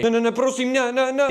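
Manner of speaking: begs not to be sent away; pleading. Acted. Dad begging his son, hammed-up panic.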